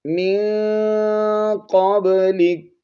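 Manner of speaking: slow, high melodic recital; Quranic recitation. Shown as a demonstration. Teacher reciting verse to students. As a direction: chant it out slowly.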